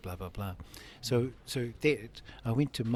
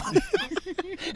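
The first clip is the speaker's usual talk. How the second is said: high burst of laughter; 7 dB louder than usual. Real, not acted. Laughs about talking freely.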